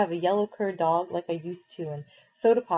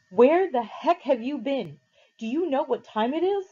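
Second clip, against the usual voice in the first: angry brother; 7 semitones higher, 5 dB louder than usual. A narrator's reading. Derry confronting his late brother, furious and loud. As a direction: tear into him for coming home late. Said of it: "He was madder than I'd seen him".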